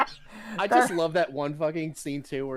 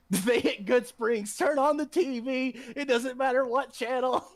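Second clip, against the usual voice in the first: mock urgent announcement; 10 semitones higher than usual. Quoting game news broadcast.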